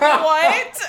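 high, loud startled what with a laugh; laughing incredulous what; shocked what. Retold or imitated. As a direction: blurt it out in disbelief. Shocked reply to jealous accusation.

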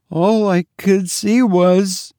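slow, high, frightened maid; falter, unable to finish for dread; hesitant horror. A narrator's reading. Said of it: "Cordelia hesitated, with an expression of utmost horror".

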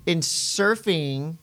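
normal talking voice

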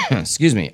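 a real cough, then excuse me; chesty cough. Real, not acted. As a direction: cough once, then apologise. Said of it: "Don't be sorry for coughing".

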